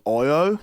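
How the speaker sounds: "Io" as a stretched Aussie O gliding upward; Australian long O. Shown as a demonstration. Explaining the accent's long O, "Io" drawn out Australian-style. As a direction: say "Io" as an exaggerated Australian O. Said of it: "they do their long O's".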